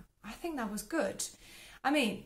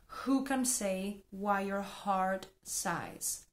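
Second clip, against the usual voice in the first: slow, careful model reading; much slower than usual. Shown as a demonstration. Teacher models the second sentence slowly for learners. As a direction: spell out each word at half speed. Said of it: "Second one".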